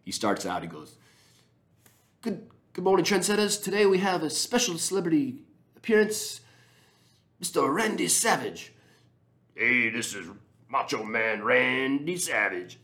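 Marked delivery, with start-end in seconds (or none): 2.2-8.7 much higher, louder, hammed-up New Zealand host voice; Kiwi coach intro. Podcaster butchers the coach's ridiculous intro, in a high, hammed-up Kiwi voice. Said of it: "this ridiculous intro that I'm butchering". 9.5-12.8 slow, raised, put-on wrestler growl of a voice; Macho Man Randy Savage. Podcaster mimics the coach doing a wrestler cameo, slow and hammed-up. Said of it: "this ridiculous intro".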